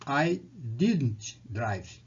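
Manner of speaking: very slow, word by word; slow model sentence. Shown as a demonstration. Teacher modelling the negative very slowly for students. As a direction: drag each word out for the class. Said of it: "você diz".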